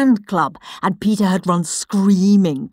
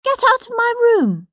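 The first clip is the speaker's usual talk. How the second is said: much higher, prim little brother voice; indignant Peter; 3 dB louder than usual. Acted. Peter ordering Henry out of his bedroom, high and prim. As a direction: shoo him out, high and prissy. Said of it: "said Peter".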